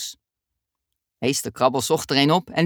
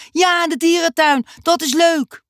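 much higher, louder excited cheer; cheering kids; 12 semitones higher, 5 dB louder than usual. Acted. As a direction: whoop with delight like a class of kids. Class cheers at the news. (juichende kinderen, veel hoger)

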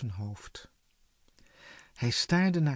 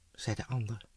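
usual speaking voice

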